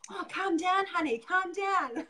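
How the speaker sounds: hammed-up condescending calm-down; patronising husband.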